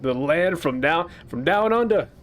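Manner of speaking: a bad, hammed-up Australian accent. Player mocking the Australian game character's voice, bad Australian accent. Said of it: "She's got an Australian accent".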